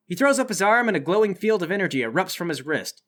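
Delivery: normal talking voice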